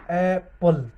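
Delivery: एप्पल split in two, opening 'ae' held; sounded out. Shown as a demonstration. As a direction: sound out एप्पल in slow syllables. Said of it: "एप्पल की निकालो". (एप्पल को तोड़कर बोलना)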